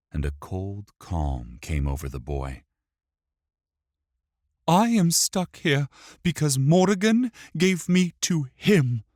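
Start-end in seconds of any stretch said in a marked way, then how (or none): 4.7-9.0 put-on high, louder child's voice, accusing; angry boy. A narrator's reading. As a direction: accuse them in a boy's high voice. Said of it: "pointed an angry finger".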